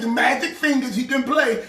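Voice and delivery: ordinary delivery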